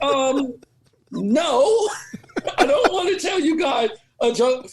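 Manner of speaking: laughing through a mock refusal. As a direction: turn it down while cracking up. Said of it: "I'm like".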